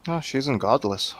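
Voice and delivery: ordinary talk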